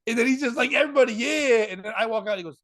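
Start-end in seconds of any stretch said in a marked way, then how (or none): 0.7-1.7 high, louder party call; mock hyping up a dance floor; imitated club hype. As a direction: whoop it up like a clubgoer. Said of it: "like he's at a club".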